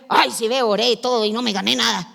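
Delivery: lightly voiced whiny believer, quick; mock complainer. Preacher mimicking a doubter who prayed and won nothing. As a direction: mimic a grumbling churchgoer. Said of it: "usted va y dice".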